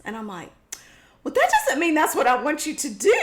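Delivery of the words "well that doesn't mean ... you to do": indignant outburst. Retold or imitated. Retold quarrel with husband.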